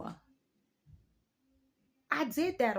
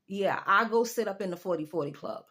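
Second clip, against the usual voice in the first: ordinary talk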